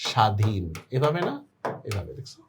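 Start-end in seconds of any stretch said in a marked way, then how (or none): 0.1-0.6 স্বাধীন said "sha-dhin", the ব silent; স্বাধীন with a plain SH and no w. Shown as a demonstration. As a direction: say স্বাধীন slowly falling, as "shadhin". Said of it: "তাহলে কি হবে উচ্চারণটা".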